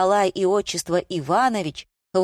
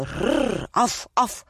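growl, then two higher-pitched yaps; dog growl and bark; 5 semitones higher, much slower than usual. Acted. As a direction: play an eager guard dog. Narrator imitates a dog barking.

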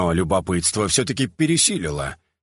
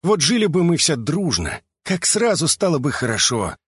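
mild higher-pitched grumble; grumbling; 6 semitones higher than usual. A narrator's reading. Third brother before a slammed door, mild grumbling. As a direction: grumble it wistfully to yourself. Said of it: "пробурчал третий".

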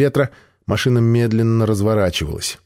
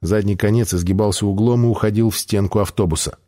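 ordinary delivery

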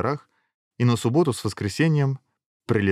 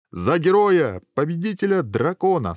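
high, ringing proclamation; king's toast; 9 semitones higher, 4 dB louder than usual. A narrator's reading. King toasting the hero, high and proclaiming. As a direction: raise a toast to the whole table. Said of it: "сам король поднял кубок".

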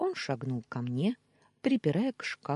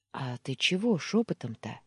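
ordinary delivery, nothing marked

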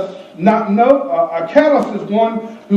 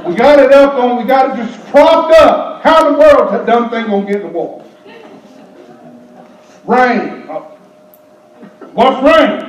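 mocking complainer; 2 semitones higher than usual. Mocking complainers about the ark.

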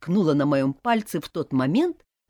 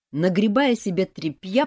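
ordinary delivery

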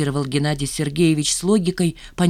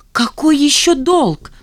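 high, loud, drawn-out indignation; flaring up; 10 semitones higher, 7 dB louder, slower than usual. A narrator's reading. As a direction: explode in outraged disbelief. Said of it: "взвилась Жанна". (возмущённо, взвившись)

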